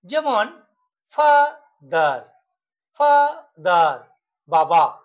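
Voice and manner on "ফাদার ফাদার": ফাদার as two slow beats, ফা, দার; broken into syllables. Shown as a demonstration. Teacher demonstrating syllables to students, ফাদার split in two. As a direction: say ফাদার with a clear gap after ফা. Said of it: "দুবার উচ্চারণ করছি".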